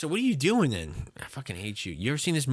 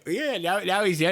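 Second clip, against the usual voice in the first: ordinary talk, nothing marked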